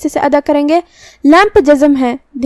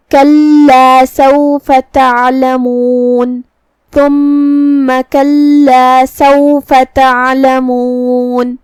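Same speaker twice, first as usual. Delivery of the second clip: slow, drawn-out melodic tilawat; Quran recitation; much slower than usual. Shown as a demonstration. Teacher recites the verse for students, slow melodic chant. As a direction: chant each word long and measured like a qari.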